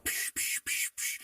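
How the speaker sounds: unvoiced puffing on a joint; imitated toking noise; puffing sound. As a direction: mime sharp drags with breathy psh sounds.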